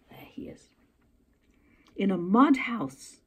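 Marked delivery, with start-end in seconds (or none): 0.1-0.7 whisper. Real, not acted. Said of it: "whispered aside to listeners".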